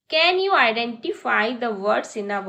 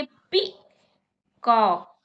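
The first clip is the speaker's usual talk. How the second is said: Peacock drawn out very slowly, syllable by syllable; slow demo; 2 semitones lower, much slower than usual. Shown as a demonstration. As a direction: stretch peacock slowly so kids can clap. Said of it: "जैसे मैं बोलूंगी". (Peacock को धीरे-धीरे खींचकर)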